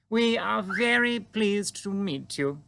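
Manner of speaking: Queen greeting the giant calmly; composed Queen. A narrator's reading. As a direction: stay perfectly poised, royal. Queen voiced in story.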